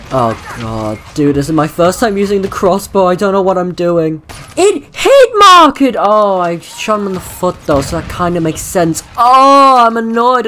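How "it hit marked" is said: high, drawn-out cry of surprise; startled shout; shocked disbelief. Real, not acted. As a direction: blurt it out in stunned disbelief. Surprised crossbow hit marker.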